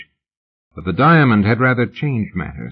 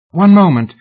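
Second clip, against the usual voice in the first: much higher and louder, calling out; woman halting the departing lady; urgent call; 10 semitones higher, 9 dB louder than usual. Acted. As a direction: stop them before they leave. Fan calls them back.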